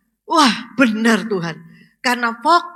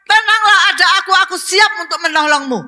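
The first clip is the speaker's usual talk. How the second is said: higher, louder, ringing call of comfort; God calling out; 7 semitones higher, 6 dB louder, faster than usual. Acted. Preacher voicing God to troubled listeners, loud and high. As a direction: proclaim it over the crowd like a bold divine voice. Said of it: "Tuhan katakan".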